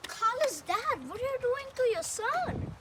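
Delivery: loud, slow alarmed calling out; alarmed shout. Acted. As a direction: shout to stop him. Teacher calls out in alarm.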